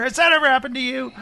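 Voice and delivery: hammed-up late-night lawyer ad; mock pitchman asking the viewer; lawyer commercial voice. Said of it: "you know those lawyer commercials".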